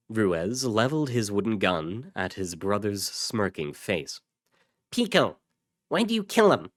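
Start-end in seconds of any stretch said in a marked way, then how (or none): 4.9-6.7 high-pitched, urgent demand; confronting. Acted. Ruiz confronting his brother over the corpses, high and demanding. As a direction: challenge him with a raised pitch.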